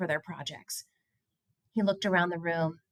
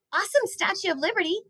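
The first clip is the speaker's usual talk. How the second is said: put-on higher, quicker excited boy; excited praise; 12 semitones higher, faster than usual. A narrator's reading. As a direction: gush at a friend's costume. Caleb praising.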